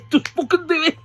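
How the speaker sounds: high, loud burst through a laugh; laughing exasperated plea. Real, not acted. Fan pleading for the feud he loves, excited outburst. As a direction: beg them loudly, half laughing with impatience.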